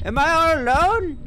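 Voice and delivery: nervous high call into the dark; scared. Real, not acted. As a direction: ask it spooked and loud. Creepy horror mood, raised voice.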